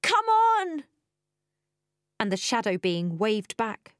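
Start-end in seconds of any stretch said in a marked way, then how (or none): 0.0-0.8 loud, high, drawn-out desperate yell; high desperate call; wrecked shout. A narrator's reading. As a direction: shout it out across a distance. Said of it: "my own wrecked voice shouting".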